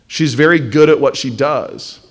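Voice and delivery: normal talking voice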